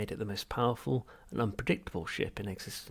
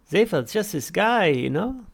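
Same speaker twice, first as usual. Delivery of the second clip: much higher, louder bright well; bright Halfrunt; 10 semitones higher, 12 dB louder, slower than usual. Acted. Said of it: "said Halfront brightly".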